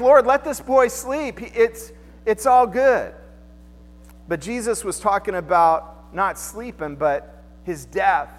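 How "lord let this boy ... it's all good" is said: higher, louder, slow pleading; hammed-up disciples begging to let him sleep; mock disciples. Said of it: "They're like".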